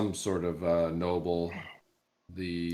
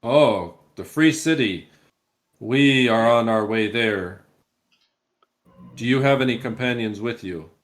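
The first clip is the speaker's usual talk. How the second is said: higher, louder captain, courteous but wary; mounted captain voice; 3 semitones higher, 8 dB louder than usual. Acted. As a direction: speak like a formal guard captain.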